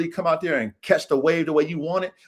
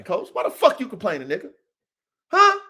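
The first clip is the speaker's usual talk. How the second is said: ordinary talk, nothing marked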